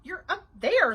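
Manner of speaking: surprised. A narrator's reading. Babysitter finding Bean in the attic, surprised. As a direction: ask it in astonishment. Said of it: "said Nancy in a surprised voice".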